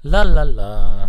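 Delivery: loud singsong mic test. Real, not acted. Testing the mic for a colleague, very loud. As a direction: belt out the la-las. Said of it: "you're very loud now".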